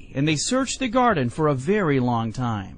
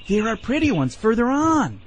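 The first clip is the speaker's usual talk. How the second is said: higher, luring storybook call; 8 semitones higher, 4 dB louder than usual. A narrator's reading. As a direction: coax onward with a raised call. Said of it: "cried Hooty every time".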